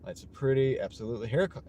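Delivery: ordinary talk, nothing marked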